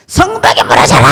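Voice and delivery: booming low battle cry; a deep roaring command at the walls; crowd shout. Retold or imitated. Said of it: "막 소리를 막 지른 거예요".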